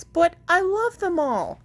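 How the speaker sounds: high, whiny plea; a touch of a child's wail; child pleading over his toys, mock tearful; mild mock cry. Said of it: "Spencer cried".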